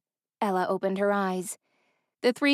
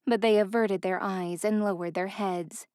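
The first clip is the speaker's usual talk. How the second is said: plain reading voice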